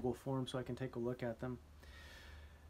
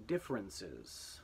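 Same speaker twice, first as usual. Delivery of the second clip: differences said slowly and lower; slow dictation; 4 semitones lower, slower than usual. Shown as a demonstration. Teacher dictating: slow clear word. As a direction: drag out differences for spelling. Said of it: "here we go".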